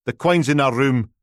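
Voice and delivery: put-on old Aberdonian man, high and loud; thick Aberdeen brogue, calling out from the door; broad Aberdonian accent. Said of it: "Aberdonian accent you could plough fields with".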